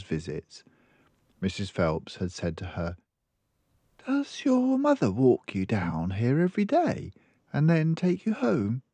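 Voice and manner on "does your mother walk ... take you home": higher, curious librarian voice; kindly librarian. Acted. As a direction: ask it like a concerned librarian. Librarian questions the child.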